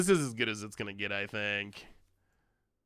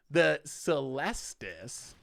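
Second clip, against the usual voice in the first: slow, deliberate Celestus with LES stressed; proud careful pronunciation; careful new stress; much slower than usual. Shown as a demonstration. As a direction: say Celestus slowly, stress on the middle syllable. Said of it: "Look at that amazing pronunciation".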